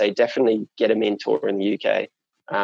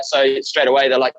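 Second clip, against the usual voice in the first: usual speaking voice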